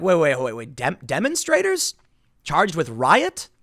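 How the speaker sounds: a baffled citizen doing a double take; mock disbelief. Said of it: "And now they're like".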